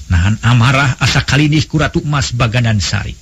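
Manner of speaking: usual speaking voice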